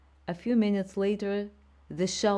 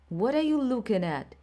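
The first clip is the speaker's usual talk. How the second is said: raised angry snap; angry shout; 6 semitones higher, 3 dB louder than usual. A narrator's reading. Father shouting at his son after the fight, angry shout. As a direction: shout at the boy staring. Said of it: "he shouted".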